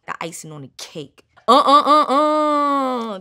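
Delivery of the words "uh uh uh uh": loud rhythmic uh chant with a laugh; Gator call. Shown as a demonstration. Rapper shows fans her Gator call, loud chanting uh. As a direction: boom out the Gator call like a signal. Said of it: "That's the big Gator call out".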